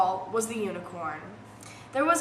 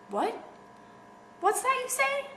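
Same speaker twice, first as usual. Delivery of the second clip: put-on much higher, perked-up child voice asking; perked-up surprise; 12 semitones higher, 4 dB quieter than usual. Peggy Ann catching news from her mother, high surprised question. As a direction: snap out of sickness, suddenly alert. Peggy hears it's Saturday.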